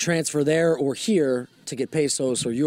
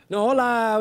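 higher, nervously polite Spanish greeting; nervous hola; 7 semitones higher, 3 dB louder than usual. Retold or imitated. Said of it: "like, nervous hola".

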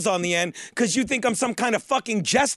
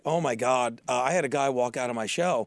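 much lower, softer mock-dramatic moan; mock upset comic; 10 semitones lower, 4 dB quieter than usual. Comedian mocking how comics once whined about walkouts, low and mopey. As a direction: play a comic wallowing over a walkout. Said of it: "you would say something like".

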